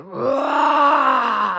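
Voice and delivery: a mock roar, high and far from his own voice; dragon roar. Dragon Dad roaring in anger, mock roar. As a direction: roar like an angry dragon father. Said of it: "he was mad".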